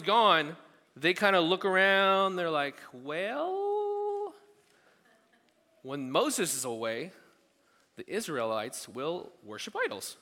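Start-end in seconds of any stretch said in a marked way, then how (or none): 2.9-10.2 a sing-song little jingle; lightly voiced Israelites chanting a rhyme; mock rhyme. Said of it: "Doesn't rhyme".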